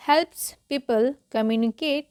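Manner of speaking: word by word with gaps between; deliberate model reading; slow read-along. Shown as a demonstration. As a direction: read very slowly for learners to repeat. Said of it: "क्या पढ़ेंगे?".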